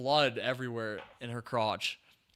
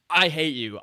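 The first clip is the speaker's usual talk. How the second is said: louder heated I hate you; angry outburst; 4 semitones higher, 6 dB louder than usual. Retold or imitated. Reviewer venting anger at Catherine on screen. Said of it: "sorry, I'm getting, like, mad".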